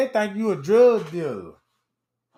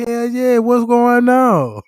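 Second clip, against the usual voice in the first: loud, slow confident lawyer voice; mock lawyer swagger; posing as lawyer; 5 semitones higher, 7 dB louder, slower than usual. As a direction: bluff like a sure-footed attorney. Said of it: "I'm like".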